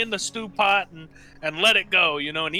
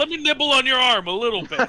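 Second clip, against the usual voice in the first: higher, faster mock-hungry ogre; playful cannibal ogre; 6 semitones higher, 4 dB louder, faster than usual. Ogre chef teases a party member about eating their arm, playfully higher and faster. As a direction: tease them like a peckish monster.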